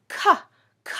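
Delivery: "k" as a short crisp k consonant sound; teacher sounding out "k" for the class; phonics sound k. Shown as a demonstration. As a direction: clip off "k" as a bare hard k with no vowel. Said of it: "I hear the sound".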